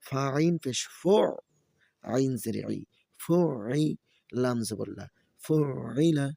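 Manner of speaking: sing-song letter-by-letter chant; Quran-primer teacher's recitation; spelling drill recitation. Shown as a demonstration. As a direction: spell each syllable, then lift the joined word. Spells out Arabic syllables for learners.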